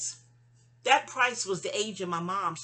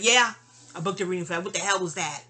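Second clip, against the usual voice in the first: emphatic loud yes; 9 dB louder, faster than usual. Real, not acted. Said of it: "defends booking reading".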